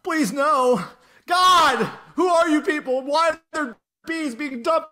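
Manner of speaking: high, loud overacted pleading; hammy stage actor. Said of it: "That was a little better than yours".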